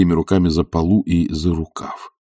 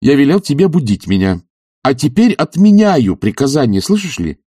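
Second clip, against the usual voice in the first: raised, insistent command; imperious; 7 semitones higher, 4 dB louder than usual. A narrator's reading. Master cancelling his order to servant, imperious. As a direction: lay down the order from on high.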